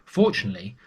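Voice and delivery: usual speaking voice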